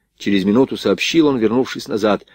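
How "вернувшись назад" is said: usual speaking voice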